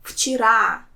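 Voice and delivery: Вчера with RA hit at the end; stress on the last syllable. Shown as a demonstration. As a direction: lean on the final RA of Вчера. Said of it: "the last one has stress".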